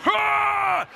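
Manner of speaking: high, sustained charging roar; animal roar. Retold or imitated. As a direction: imitate the goalie's wild roar. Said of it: "the animal comes growling at you".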